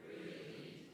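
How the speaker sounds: "Free." breathed without voice; whispered answer. Shown as a demonstration. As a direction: let the crowd fill in "Free.".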